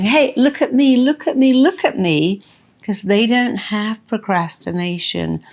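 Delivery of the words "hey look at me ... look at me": put-on excited child. A child shouting to Mummy from the pool, high and excited. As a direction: squeal it like a kid showing off. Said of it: "notice all the kids going".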